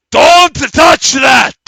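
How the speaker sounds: huge, high, drawn-out yell; alarmed shout. Acted. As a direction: shout a warning at full force. Strange man warns characters off statue.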